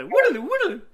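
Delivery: high-pitched wobbly dream-sequence sound; mock flashback "woodaloo" in a high voice; Wayne's World flashback noise. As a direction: imitate a cheesy flashback transition, leaping up high. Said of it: "like Wayne's World when they do the".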